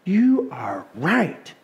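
slow, drawn-out dawning agreement; slow conviction. Acted. Listener won over to the word, slow conviction. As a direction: let it land word by word, slowly. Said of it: "And you go".

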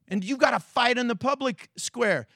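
usual speaking voice

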